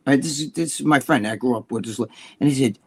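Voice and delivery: usual speaking voice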